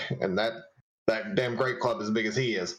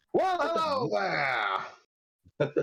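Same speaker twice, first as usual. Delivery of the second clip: put-on booming Australian accent; 9 semitones higher than usual. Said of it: "In a booming Australian accented voice".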